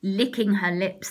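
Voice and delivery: usual speaking voice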